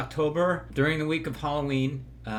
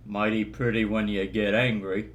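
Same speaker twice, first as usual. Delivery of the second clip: John Wayne impression; 4 semitones lower than usual. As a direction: lower drawling cowboy voice. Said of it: "John Wayne".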